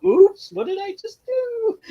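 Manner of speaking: high, slow faux-innocent whine; mock innocent. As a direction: play dumb, drawn out. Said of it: "He's like".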